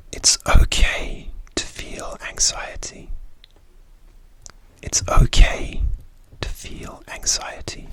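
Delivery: slow whispered affirmation; whispered pep talk. Acted. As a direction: whisper it slowly like reassurance. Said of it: "The first one is".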